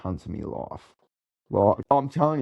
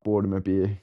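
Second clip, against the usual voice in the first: normal talking voice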